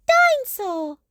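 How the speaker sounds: high sleepy dinosaur cry; yawning dinosaur. Acted. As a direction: stretch into a yawn mid-roar.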